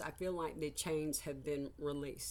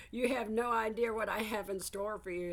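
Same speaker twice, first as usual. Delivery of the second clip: teasing Spirit voice; 6 semitones higher, 4 dB louder than usual. Retold or imitated. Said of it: "Spirit's like".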